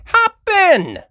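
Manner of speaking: high, loud New York voice; wisecracking driver; New York cabbie. Acted.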